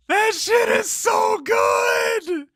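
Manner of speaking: slow, high, loud yell of delight; ecstatic drawn-out shout. Real, not acted. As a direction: stretch it out, shouting with joy. Ecstatic praise of own work.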